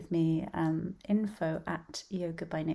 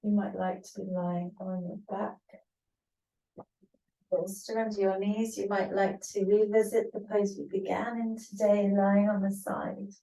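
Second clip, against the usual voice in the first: unhurried instruction with long pauses between phrases; spaced-out relaxation teacher's voice; guided yoga nidra; 4 dB louder than usual. Acted. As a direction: guide a class gently into rest, phrase by phrase.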